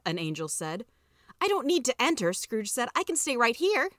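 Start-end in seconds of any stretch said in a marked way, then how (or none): 1.4-2.3 high-pitched stubborn protest; refusing to budge; defiant. Acted. Scrooge argues with the angel. 2.9-3.9 high-pitched stubborn insistence; cheeky refusal to leave; defiant. Acted. Scrooge argues with the angel.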